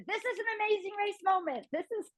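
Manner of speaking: high, breathy hyped-up son voice; mock the son's big TV moment; teasing impression. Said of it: "Danny always turns it to".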